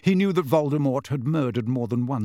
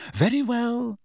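high, strained cracking voice; 10 semitones higher than usual. A narrator's reading. Upset mother giving in to Harry, voice cracking. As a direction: let the pitch break upward on a strained reply. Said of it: "her voice cracking".

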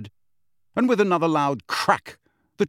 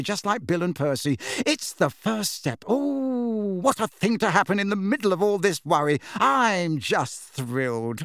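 gushing mother; 7 semitones higher than usual. Acted. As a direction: gush proudly, stretching the "oh"s high. Mother gushing in story dialogue.

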